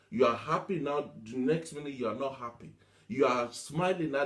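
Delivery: ordinary talk, nothing marked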